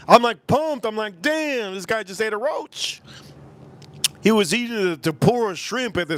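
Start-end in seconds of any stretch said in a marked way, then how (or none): 1.2-3.0 higher, amazed; mock shocked exclaim. At sushi dinner, retelling amazed reaction. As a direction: gasp it like he ate a roach.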